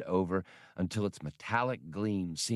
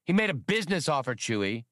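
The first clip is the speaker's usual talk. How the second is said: higher, fast, hurried excuse; defensive; 6 semitones higher, faster than usual. Acted. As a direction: blurt it out quickly to dodge blame. Han defends himself in argument.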